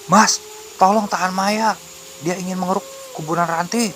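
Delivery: high, strained call for help; alarmed, high-voiced begging; urgent plea. A narrator's reading. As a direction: plead high-pitched for help holding her. Urgent plea to restrain Maya.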